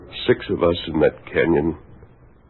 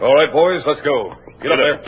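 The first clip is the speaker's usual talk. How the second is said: loud, high call to move out; rally the riders forward; leading the men on; 9 semitones higher, 3 dB louder than usual. Acted. Captain ordering his Rangers to ride, loud call.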